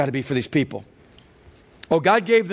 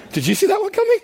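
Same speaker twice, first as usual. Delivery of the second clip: much higher, incredulous squeak; shocked disbelief; faster than usual. Real, not acted. Pastor reacting to the snakes verse, incredulous. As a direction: sound floored by the twist.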